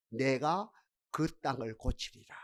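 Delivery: low, slow and very soft; quoted scripture in a deep hush; hushed divine promise. Retold or imitated. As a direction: let God's promise settle softly and slowly. Voicing God's promise from scripture.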